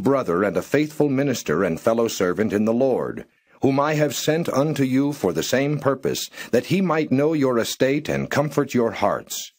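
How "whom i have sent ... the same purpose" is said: usual speaking voice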